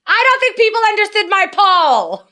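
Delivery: high-pitched mock yell; imitated yelling. Co-host mimics her yelling at everybody about the poll, high mock yell. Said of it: "then she yells at everybody about the poll".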